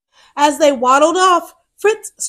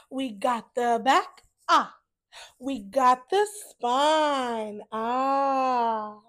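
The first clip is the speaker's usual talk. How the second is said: put-on teaching children; 11 dB quieter than usual. Shown as a demonstration. To young listeners, explaining book parts, sing-song teacher voice. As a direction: point out each part of the book. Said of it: "teaching book parts to children".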